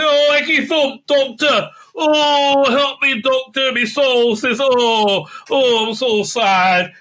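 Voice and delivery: high, loud, hammed-up whining; mock whiny plea. Mocking Yaz pleading with the Doctor.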